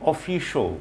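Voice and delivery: "অফিশিয়াল" with the ending cut short to "sho"; correct pronunciation. Shown as a demonstration. Teacher repeats the corrected word, correct pronunciation. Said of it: "শিয়ালটাকে খুব সংক্ষেপে বলতে হবে".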